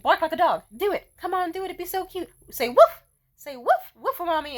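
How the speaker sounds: high, loud teasing commands; playful coaxing. Retold or imitated. Woman urging a man to bark, playful coaxing. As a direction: coax him like a pet. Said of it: "like, I'd say".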